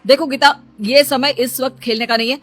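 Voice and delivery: annoyed scolding. Acted. Husband scolding his wife over the hidden file, loud and annoyed. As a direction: scold her like a child.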